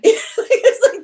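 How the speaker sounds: high laughing burst. Real, not acted. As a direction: break into a laugh. Laugh before mocking quote.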